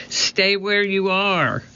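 high, slow warning call; shouting. A narrator's reading. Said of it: "Jack shouted".

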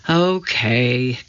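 reluctant, sighed okay; sighing. A narrator's reading. Jack gives in to his sister Annie, sighing. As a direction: give in with a drawn-out sigh. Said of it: "He sighed".

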